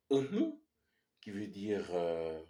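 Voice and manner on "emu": emu said high and clear, e-MU; teacher pitching up emu as a model word; Kanak word demo. Shown as a demonstration. Teacher to class, model word emu said high. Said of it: "vous avez le mot".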